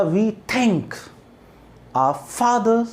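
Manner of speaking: light "We", drawn-out stressed "thank"; pause, then light "our" and a stretched "fathers"; unstressed-stressed rhythm. Shown as a demonstration. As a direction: recite in beats, leaning on "thank" and "fathers". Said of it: "और ये stress था".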